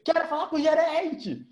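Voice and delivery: high, drawn-out mock demand; indignant demand. Retelling the open bar row, indignant demand. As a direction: demand it like an outraged customer, slowly. Acted complaint demanding the manager.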